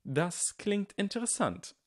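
high, slow, mannered drawl; affected. A narrator's reading. Draco feigns interest in Harry's challenge, affected. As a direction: make the interest showily artificial. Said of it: "sagte Draco besonders laut und affektiert".

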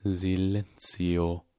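very slow, drawn-out cold incantation; spell incantation. A narrator's reading. Snape silences Harry with a spell. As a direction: cast it deliberately.

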